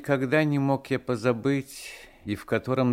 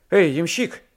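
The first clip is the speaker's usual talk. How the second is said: loud, raised hail; shouted call; 8 semitones higher, 7 dB louder than usual. A narrator's reading. Passenger hails the coachman in a storm, shouting. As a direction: call out sharply for attention. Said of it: "закричал я".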